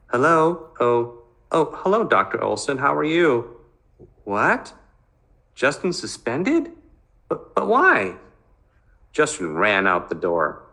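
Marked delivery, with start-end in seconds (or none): 0.1-3.6 higher, bright phone voice of mother; polite phone greeting. Acted. Mother picking up the doctor's call, higher and polite. 4.2-8.2 much higher, stunned mother; shocked disbelief. Acted. Mother hearing on the phone her son is suspended, high and shocked.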